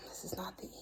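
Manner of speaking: soft unvoiced whisper, stress on "not"; whispered correction. Real, not acted. Mom catching herself closing the video, whispering. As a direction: breathe "This is not the end" as a hushed self-correction. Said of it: "why am I closing the video?".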